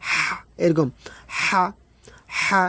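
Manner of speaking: হা with a hissing breath from the throat; throaty ha. Shown as a demonstration. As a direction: push হা out with a shushing throat sound. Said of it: "গলার মধ্যে শো শো করে শব্দ".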